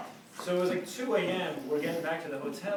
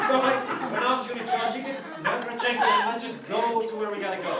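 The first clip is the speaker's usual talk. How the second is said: loud pleading; 6 semitones higher, 7 dB louder than usual. Retold or imitated.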